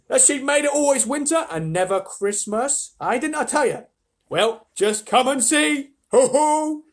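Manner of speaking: high, loud told-you-so; triumphant beaver. Acted. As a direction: crow over being right.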